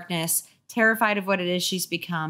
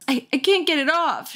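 sputtering; 8 semitones higher than usual. Acted. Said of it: "she sputtered".

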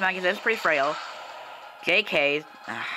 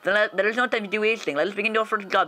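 higher bad Russian accent voice; 4 semitones higher than usual. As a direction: read the NPC in a hammy Russian accent. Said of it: "I know my Russian accent is probably".

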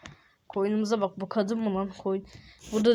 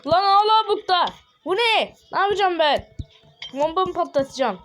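high, loud exasperated cry; frustrated outburst; 7 semitones higher, 4 dB louder than usual. Acted. Gamer shouting at the villager still standing there, loud frustration. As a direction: blow up in mock despair.

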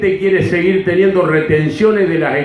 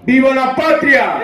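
rally cheer; 7 semitones higher than usual. Real, not acted. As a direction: call the crowd to cheer. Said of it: "gritemos todos juntos".

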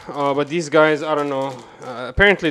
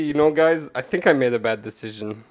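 ordinary delivery

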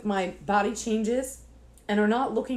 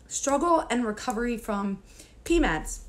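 ordinary delivery

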